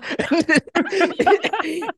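high loud burst of laughter; laughing. Real, not acted. Laughing about the sister's dramatic yell. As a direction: crack up at the memory.

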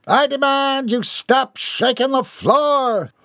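high, slow, indignant order; haughty demand. Acted. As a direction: command the sea to behave. Seasick boy demands.

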